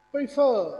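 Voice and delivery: Prefer loud and high on फर; prefer as pre-FERR; stress on FER. Shown as a demonstration. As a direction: hit फर hard in prefer. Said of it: "किसको stress किया".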